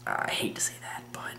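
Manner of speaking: hushed whispered confession; whispering. Real, not acted. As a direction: drop to a whisper, as if embarrassed. Admitting something awkward.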